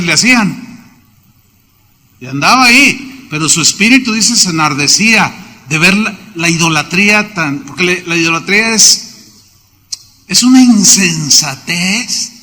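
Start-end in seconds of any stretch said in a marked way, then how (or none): none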